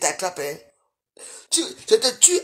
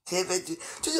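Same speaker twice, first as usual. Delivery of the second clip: breathy whispered aside; 4 semitones lower, 9 dB quieter than usual. Real, not acted. Whispering an aside to her live viewers. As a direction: drop to a whisper mid-rant.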